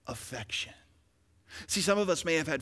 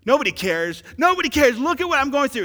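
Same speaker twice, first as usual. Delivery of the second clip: complaining; 9 semitones higher, 10 dB louder than usual. Retold or imitated. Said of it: "I would say".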